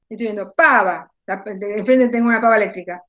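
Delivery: ordinary talk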